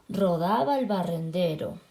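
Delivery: ordinary delivery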